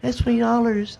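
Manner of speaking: sugary high voice mocking a stripper's sorry; mock sweet stripper voice. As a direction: coo it like a fake-sweet dancer. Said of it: "He's like".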